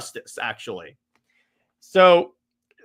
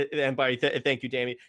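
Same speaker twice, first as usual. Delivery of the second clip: ordinary delivery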